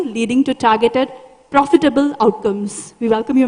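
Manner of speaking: ordinary delivery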